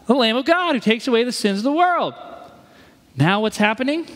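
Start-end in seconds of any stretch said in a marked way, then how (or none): 0.1-2.1 exclaiming. Retold or imitated. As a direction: point him out with wonder. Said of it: "he said".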